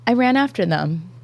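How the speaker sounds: normal talking voice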